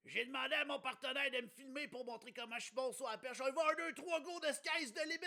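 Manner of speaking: high loud boastful jock; cocky athlete voice. Pretend. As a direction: shout it like a cocky show-off. Redoing pole vaulter role.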